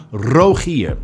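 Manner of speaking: "Rogier" with a trilled R at the front of the mouth; "Rogier" said the way his mother says it, R trilled with the tongue tip; front rolled R. Shown as a demonstration. Son imitating how his mother says his name, trilled R. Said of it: "in the front of the mouth".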